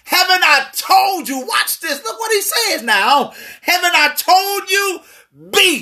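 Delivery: God insisting, voice lifted; emphatic reminder. Retold or imitated. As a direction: press it home. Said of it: "God is reiterating".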